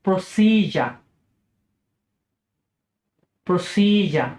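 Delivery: British প্রসিজার as PRO-si-jer; British pronunciation. Shown as a demonstration. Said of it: "British উচ্চারণ হচ্ছে".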